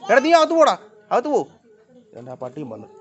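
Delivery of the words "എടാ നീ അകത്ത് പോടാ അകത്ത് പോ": much higher, loud scolding shout; shooing yell. Real, not acted. Owner ordering the dog indoors, loud high shout. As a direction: chase him inside with a sharp yell.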